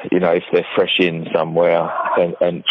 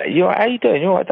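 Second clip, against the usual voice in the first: much higher-pitched mock small talk; chirpy greeting, acted; 12 semitones higher, much faster than usual. Hairdresser greeting a client who may break down, high-pitched acted greeting. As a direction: put on a high-pitched "how are you".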